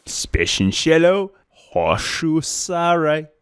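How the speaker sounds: "hoshusare" stretched as if played in reverse; speaking backwards. Shown as a demonstration. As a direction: draw out "Speshenshelo hoshusare" syllable by syllable. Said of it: "Reverse that".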